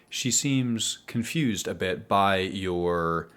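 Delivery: ordinary delivery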